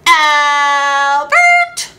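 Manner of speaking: a loud sing-song Albert!; wife hollering for her husband; wife yells. Acted. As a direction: call Albert! in a singing yell. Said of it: "the farmer's wife yelled".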